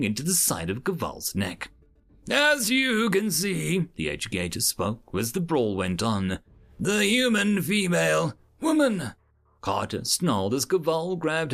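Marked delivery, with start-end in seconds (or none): 2.3-3.9 high, slow, measured instructor voice; lecturing educator. A narrator's reading. 6.8-9.1 high-pitched instructor voice; lecturing educator. A narrator's reading.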